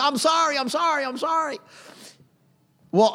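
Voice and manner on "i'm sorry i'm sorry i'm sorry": put-on high, hurried kid-like pleading; mock pleading of children being chased; repeated apology in a raised child's pitch; imitating frightened kids. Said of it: "we were kids, and then we said".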